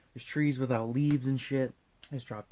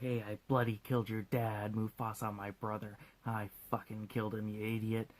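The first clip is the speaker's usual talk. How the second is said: softer, hushed villain's admission; lightly acted confession; 3 semitones lower, 7 dB quieter than usual. Scar confesses to Simba, hushed mock confession. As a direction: confess it quietly, mildly acted.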